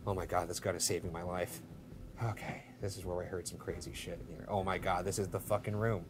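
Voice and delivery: very low, soft aside; nervous, barely voiced murmur; hushed dread. Real, not acted. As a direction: talk to yourself so nothing hears. Muttering to self in fear.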